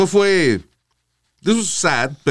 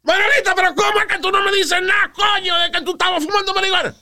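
high, furious yelling at wife; angry shout. Retold or imitated. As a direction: yell it like an outraged father. Padre regañando a la madre. (grito furioso e indignado)

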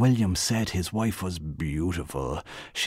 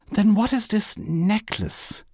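high, slow, huffing demand; lightly voiced pompous wife; puffed-up indignation; 14 semitones higher, slower than usual. A narrator's reading. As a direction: swell up like an offended matron. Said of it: "blowing herself out again".

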